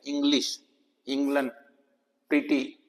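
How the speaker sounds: इंग्लिश, इंग्लैंड, प्रीटी quietly with short "i"; soft word-by-word model of short "i" in इंग्लिश, इंग्लैंड, प्रीटी; short-i demo. Shown as a demonstration. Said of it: "ई की आवाज छोटी की". (इंग्लिश, इंग्लैंड, प्रीटी में छोटी इ, धीमे से)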